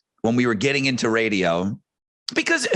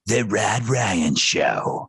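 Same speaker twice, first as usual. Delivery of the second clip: slow, low announcer drawl; imitating a grand radio voice; radio station voice-over; 5 semitones lower, much slower than usual. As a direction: stretch it out like a big station imager. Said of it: "like, this great voice".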